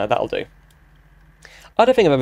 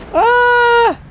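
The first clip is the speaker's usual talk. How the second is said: startled yelp; 4 dB louder than usual. Real, not acted. Crash in driving game.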